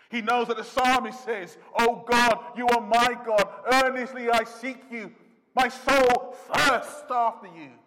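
high, loud, soaring sermon voice; impassioned preaching. Acted. Preacher crying out Jesus' thirst, loud and impassioned. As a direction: cry it out from the pulpit. Preaching psalm at full force.

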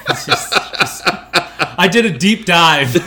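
breaking into laughter mid-sentence; laughing. Real, not acted. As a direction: laugh through the words. Cracking up at his own joke.

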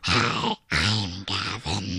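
mock his greeting as a deep troll. Mimics Gavin's introduction in a deep voice.

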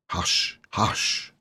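whispered hush; soft hush. Acted. Sister whispering to calm crying Jane. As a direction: soothe her in a whisper.

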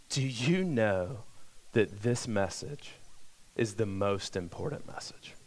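drops to a near whisper; hushed. Real, not acted. Preacher hushing the congregation to ask softly.

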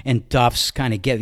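plain reading voice

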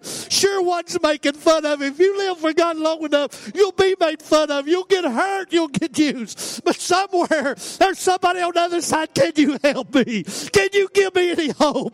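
high, loud revival cry with stretched phrases; full-force preaching. Real, not acted. Preacher urging church to live for others, full-force preaching. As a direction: cry it out to the whole church, pitched well up.

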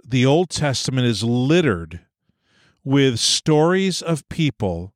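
ordinary talk

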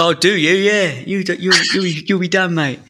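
high, cheeky Cockney warning; mock-threaten like a Cockney geezer. Host teasing the co-host with a hammed-up Cockney warning. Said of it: "when you do a Cockney accent".

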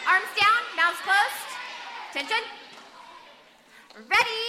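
loud drill commands; commanding. Real, not acted. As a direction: bark orders to the group. Calling the group to order.

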